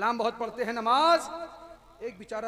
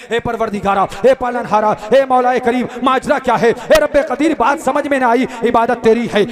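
loud, rhythmic appeal; preaching invocation; 4 semitones higher, 20 dB louder than usual. Real, not acted. Preacher turns to the divine court, invoking loudly. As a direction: call upon God in short declaimed phrases.

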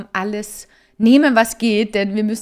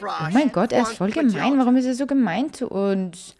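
usual speaking voice

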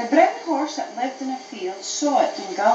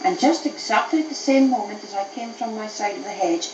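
usual speaking voice

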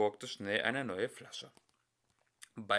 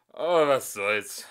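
slow loud theatrical sigh; dramatic; 2 semitones higher, 10 dB louder than usual. Acted. Singer shrugs off politics, dramatic and loud. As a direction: play it grand and theatrical. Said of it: "mit dramatischer Gebärde".

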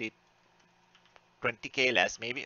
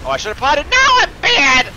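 suddenly loud and much higher; frustrated outburst; 13 semitones higher, 14 dB louder than usual. Real, not acted. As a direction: yelp at your own mistake. Misplays in a raid fight.

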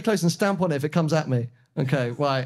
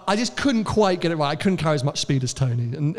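ordinary talk, nothing marked

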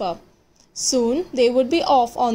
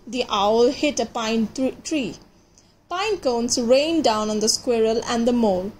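ordinary delivery

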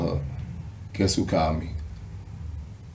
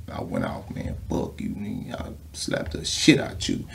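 hushed retold outburst; 5 semitones lower, 7 dB quieter than usual.

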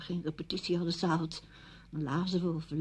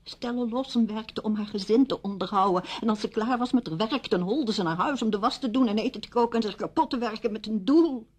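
heated, higher-pitched protest building in force; indignant outburst; 3 semitones higher than usual. Acted. Widow protesting about pointless little jobs, indignant. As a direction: pour out the frustration, voice pitched up.

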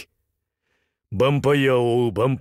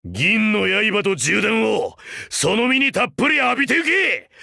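attack declaration; 10 semitones higher than usual. Acted. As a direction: announce the finishing move with relish.

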